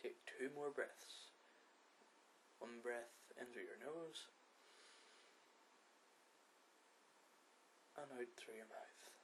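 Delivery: guided meditation. Acted. Podcast host guiding listeners through breathing, soft meditation voice. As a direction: lead the breathing gently with long pauses.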